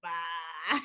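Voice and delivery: bye in a low, mocking Texas twang; mock Texas accent. Drunk Georgian parodying his goodbye, mocking Texas accent. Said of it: "like you were from Texas or something".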